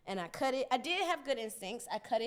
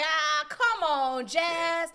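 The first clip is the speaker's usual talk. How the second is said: much higher and louder, slow dismayed groan; exasperated brother; 8 semitones higher, 12 dB louder, much slower than usual. Retold or imitated. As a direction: wail at her like a horrified big brother. Brother sees ruined haircut.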